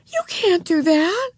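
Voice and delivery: high, slow protest; horrified. A narrator's reading. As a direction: protest in shocked disbelief, voice up. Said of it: "cried Beezus, horrified".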